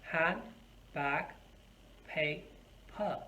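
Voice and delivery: "Hand, bag, pay, pa" as separate beats; syllable by syllable. Shown as a demonstration. Teacher repeats words for syllable counting, split apart. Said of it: "in these two words, how many syllables".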